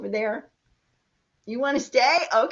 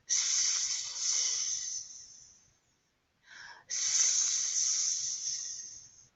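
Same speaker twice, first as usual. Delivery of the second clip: snake hiss; 5 dB quieter than usual. Shown as a demonstration. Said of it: "what sound they make?".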